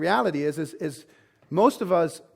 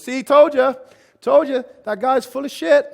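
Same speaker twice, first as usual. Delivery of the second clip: loud, high I-told-you-so jeer; gloating inner voice; 7 semitones higher, 9 dB louder than usual. Acted. The client's angry salamander mocking the salesman, loud gloating jeer. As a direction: crow it triumphantly. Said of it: "their angry salamander goes".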